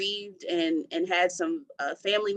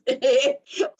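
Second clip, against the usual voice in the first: a high, wailing half-cry laugh; a real laugh that tips toward crying; 4 dB louder than usual. Real, not acted. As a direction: break into a pained high laugh. Laughing at her own situation.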